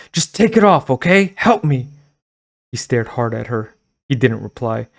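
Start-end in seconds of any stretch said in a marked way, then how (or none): 0.1-1.9 much higher and louder plea; desperate shout. Acted. Girl shouting at the store owner to remove the mask, desperate. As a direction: shout at him to help.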